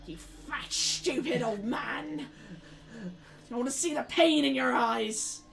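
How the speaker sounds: high, loud hag screaming at her captive; furious witch. Acted. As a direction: spit every word at him like a vengeful crone. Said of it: "She's like".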